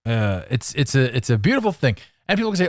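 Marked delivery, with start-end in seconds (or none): none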